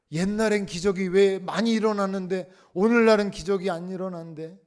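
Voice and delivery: high, loud, fervent preaching; full-force preaching. Acted. Pastor urging the congregation to trust God, loud preaching.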